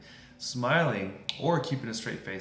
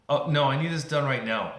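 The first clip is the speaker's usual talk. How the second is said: louder, insistent customer; escalating demand; 3 semitones higher, 7 dB louder than usual. Acted. Irritated customer refuses to wait for the clerk, insistent. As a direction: act out a pushy, impatient customer. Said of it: "then things escalate".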